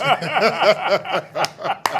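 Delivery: hearty laugh at his own story; real laughter. Real, not acted. Storyteller cracking up over his friend's comeback, real laughter. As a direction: crack up after the punchline.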